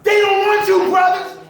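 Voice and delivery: loud, high preaching to the crowd; preaching shout. Real, not acted. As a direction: call it out like a sermon. Preaching to the brothers, full force.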